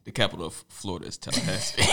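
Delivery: mock deep schoolboy voice. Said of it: "you should be talking like this".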